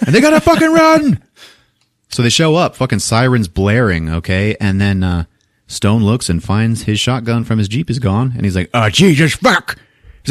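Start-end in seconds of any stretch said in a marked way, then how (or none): 0.1-1.2 high, loud dramatic exclamation; excited storyteller yelling; urgent yell. Retold or imitated. Urgent turn in retold story. 8.7-9.8 higher, louder panicked cursing, acted; hammed-up dismay; mock alarm. Acts Stone finding shotgun gone.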